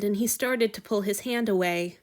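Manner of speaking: ordinary delivery, nothing marked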